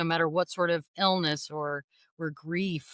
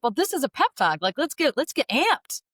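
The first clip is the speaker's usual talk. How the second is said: higher, eager pep talk voice; pumped-up coach; 9 semitones higher, 4 dB louder than usual. Retold or imitated. Husband as little league coach, mock pumping her up, excited. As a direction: fire her up like a soccer coach. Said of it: "He's like".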